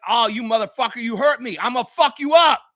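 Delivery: high, rising fighting retort, loud finish; acted vengeful anger. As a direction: build to an angry threat. Models the fighting response.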